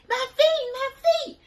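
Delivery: very high, loud wailing whine; whiny complaint. Retold or imitated. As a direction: wail about freezing feet. Friend whining about freezing feet.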